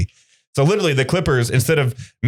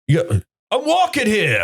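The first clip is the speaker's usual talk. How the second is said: much higher hammed New York yell; Queens accent; 10 semitones higher, slower than usual. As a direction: shout it like a cab-dodging New Yorker. Classic New York movie quote.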